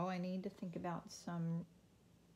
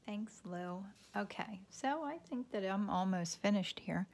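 normal talking voice

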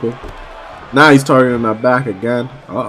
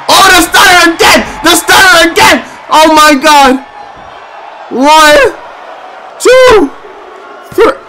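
loud hyped play-by-play; excited shout; 13 semitones higher, 4 dB louder than usual. Real, not acted. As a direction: yell the big finish. Shouted count on big move.

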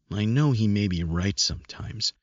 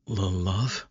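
stuttering disbelief. A narrator's reading. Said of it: "not believing and stuttering".

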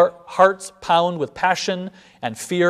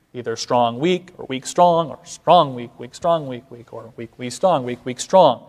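"strong" stressed, "weak" said lighter and lower; beat pattern; 3 semitones lower than usual. Shown as a demonstration. Teacher spells out meter beats: stress each "strong". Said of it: "in a feeling of two or a feeling of three".

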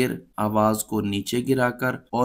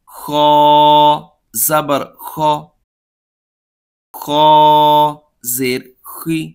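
read-along drill; 2 semitones higher, 5 dB louder than usual. Shown as a demonstration. Teacher drilling pupils on vowel marks, loud, deliberate call-and-repeat. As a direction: call each syllable out clearly so the class can echo it. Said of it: "اس کو پڑھنے کا انداز ہمارا یہ ہوگا".

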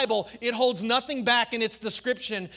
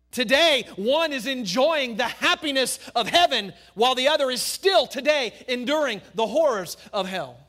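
loud, climbing pulpit delivery; full-force preaching; 6 semitones higher, 10 dB louder than usual. Acted. Pastor thundering at church about heaven and hell, at full force. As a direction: preach it hard to the congregation. Preacher drives home the contrast.

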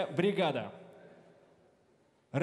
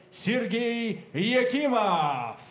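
drawn-out name call; 3 semitones higher, much slower than usual. Real, not acted. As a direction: stretch the name for the crowd. Announcer calls out fighter's name. (протяжно объявить имя боксёра)

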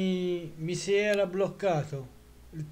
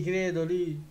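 ordinary talk

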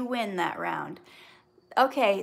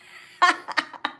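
loud, high burst of laughter; hearty laugh; 9 semitones higher, 9 dB louder than usual. Real, not acted. Host admitting she dislikes some people, bursting into laughter. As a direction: laugh out at your own admission. Laughs at her own admission.

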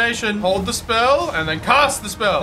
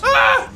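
short, high, loud yelp; startled scream; 5 dB louder than usual. Real, not acted. Player finding no footing, high startled yelp. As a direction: yelp out in sudden shock. Startled while falling through.

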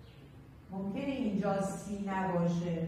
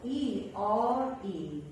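slow spelling; much slower than usual. Shown as a demonstration.

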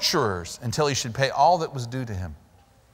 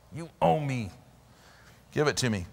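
quiet menace; 6 dB quieter than usual. Acted. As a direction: menace him quietly, no shouting. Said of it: "He really got angry with him".

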